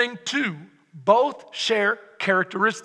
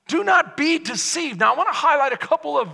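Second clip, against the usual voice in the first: normal talking voice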